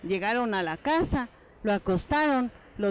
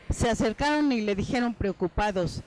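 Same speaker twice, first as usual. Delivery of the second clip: usual speaking voice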